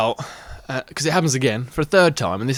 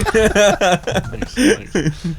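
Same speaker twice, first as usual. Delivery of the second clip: laughing thanks, a bit louder; 4 semitones higher, 4 dB louder than usual. Real, not acted. Guest laughing off the friends cutting in, laughing thanks. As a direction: laugh your way through the thanks.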